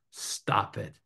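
soft, near-whispered order; quiet admonition after a pause; hushed command. Real, not acted. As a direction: drop your voice for the punchline. Repeated punchline advice.

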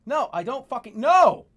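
building to a loud, high "no!"; refuse, then burst out on the last word; frustrated outburst at the end; exasperated refusal. Real, not acted. Refusing what the app asks.

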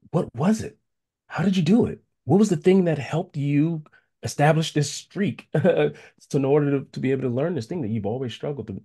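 high-pitched, eager retold questions; keenly curious, voice lifted high; eager questioning. Acts out his eager questioning of friend.

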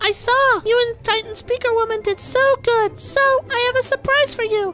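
very high, squeaky little character voice; excited little Seedling. Pretend. Seedling rewarding the player for the quest, squeaky and pleased. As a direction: pipe up like a tiny, pleased helper.

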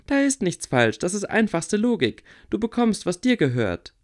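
put-on a stubborn girl heatedly laying out her logic; Theresa, insistent. A narrator's reading. Theresa voiced higher, arguing.

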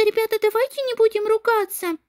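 usual speaking voice

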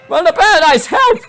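high shout rising to a squeal; hammed-up high plea; cry for help. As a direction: call frantically for backup. Calls a card for help.

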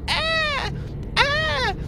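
high shrieking death cry; dying roach scream. Retold or imitated. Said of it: "That's what it sounds like".

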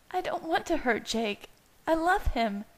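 high-pitched, pained pleading; tearful plea. A narrator's reading. Bella defends her love for Jake to Leah, tearful. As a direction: plead through rising upset.

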